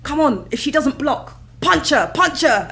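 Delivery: loud, urgent coach shouting from the ringside; trainer yelling. Retold or imitated. Trainer urging her boxer on, loud coaching shout. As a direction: bark it like a fight coach. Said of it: "you would hear my trainer saying".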